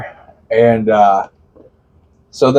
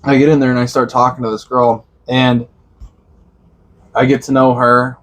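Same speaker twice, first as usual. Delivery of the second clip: ordinary talk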